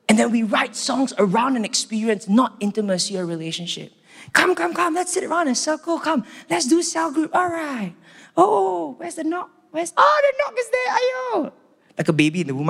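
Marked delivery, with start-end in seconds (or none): 4.3-11.5 much higher, bubbly hurried gathering call; mock eager group leader. Mocking a cell group huddling around the knock, high giddy voice. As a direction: round everyone up like a giddy leader.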